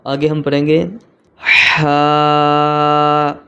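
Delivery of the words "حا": ہا from mid-throat, vowel held long; raspy pharyngeal h in ہا, drawn out; throaty ح. Shown as a demonstration. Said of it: "ان میں ہا کی آواز نکالنی تھوڑی مشکل ہے". (ہا حلق کے درمیان سے، لمبا کھینچ کر)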